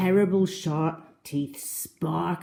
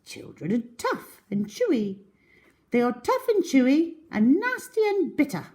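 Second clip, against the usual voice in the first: high, louder disgusted protest; high small crocodile; 9 semitones higher, 5 dB louder than usual. Acted. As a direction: insist it's revolting. Small croc argues, high pitch.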